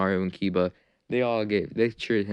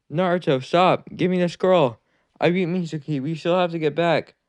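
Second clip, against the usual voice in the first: higher, louder yelling to stop; urgent shout; 7 semitones higher, 6 dB louder than usual. Acted. Teacher chases his student, yelling at him to stop. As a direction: yell after him to halt. Iruka shouts after running boy.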